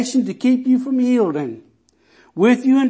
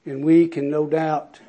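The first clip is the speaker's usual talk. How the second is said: ordinary talk, nothing marked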